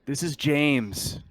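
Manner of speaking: relieved survivor giving directions. Acted. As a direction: James telling the Marines where to meet. GM voices survivor James.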